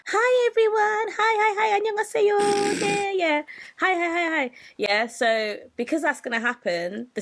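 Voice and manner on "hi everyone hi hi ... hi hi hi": idol greeting fans. Retold or imitated. Idol greeting stadium fans from a cart, sweet and high. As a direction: wave to the crowd in a sweet high voice. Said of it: "to be like".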